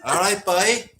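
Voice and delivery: put-on Cork greeting with a laugh, rising on boy; sing-song Cork lilt through a laugh; laughing Cork accent. Shown as a demonstration. Friend greets the host in a laughing Cork accent. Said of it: "Go on, you, you can do it".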